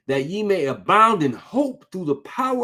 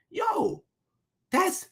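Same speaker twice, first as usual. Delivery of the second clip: awed exclamation; 13 semitones higher, 6 dB quieter than usual. Real, not acted. Exclaims in awe.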